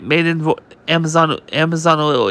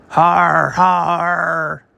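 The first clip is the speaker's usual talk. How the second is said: hammed-up gloating "har, har"; mock thug laugh. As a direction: laugh like a jail bully mocking the newbie. Bully prisoner taunts the newbie.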